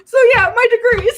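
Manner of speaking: high, loud, dissolving into laughter; flustered laughing. Real, not acted. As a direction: try to resume, then crack up. Flustered return to topic, laughing.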